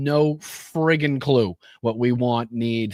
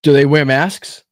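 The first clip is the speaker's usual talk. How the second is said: loud demand; 9 dB louder than usual. Real, not acted. Pressing the guest on masks.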